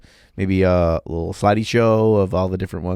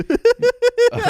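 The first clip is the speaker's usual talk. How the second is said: high burst of laughter; 5 dB louder than usual. Real, not acted. Laughing at his own joke.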